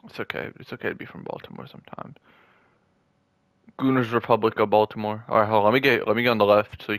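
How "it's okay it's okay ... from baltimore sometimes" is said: soft, fast whispered aside; teasing whisper. Real, not acted. Teasing his friend from Baltimore in a whisper. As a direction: mutter it under your breath as a joke.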